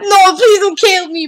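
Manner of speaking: loud, slow mock begging; playful pleading. Gamer to the friend playing shark, playful pleading. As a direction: beg for your life in a game.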